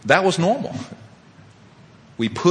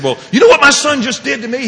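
high, loud indignant question; outraged complaint; 12 semitones higher, 7 dB louder than usual. Retold or imitated. Father telling his sponsor about his son, acted indignation. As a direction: tattle in hammed-up outrage.

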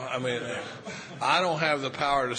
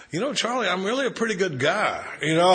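higher, louder mock self-defence; protesting innocence; 7 semitones higher, 6 dB louder than usual. Retelling a man's excuse to Charlie, mock pleading. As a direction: plead your case in a raised, imitating voice. Voicing a man pleading his case.

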